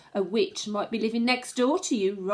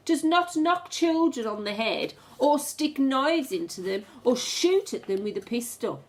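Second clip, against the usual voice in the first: plain reading voice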